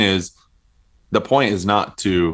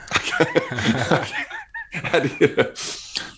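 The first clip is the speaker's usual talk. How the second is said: high embarrassed laugh; 9 semitones higher, 4 dB quieter than usual. Real, not acted. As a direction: giggle high and light. Laughs at own burp.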